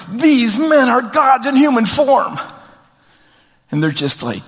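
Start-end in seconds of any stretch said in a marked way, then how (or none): 0.1-2.4 higher, louder and slow, like a stunned crowd; awed crowd shout. Retold or imitated. Crowd in Lystra hailing Paul and Barnabas, awed shout. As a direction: cry it out as if seeing gods walk. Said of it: "they shouted in their local dialect".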